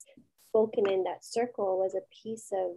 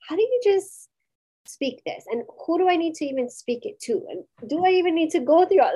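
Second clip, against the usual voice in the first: anguished questioning; 7 semitones higher, 5 dB louder than usual. Retold or imitated.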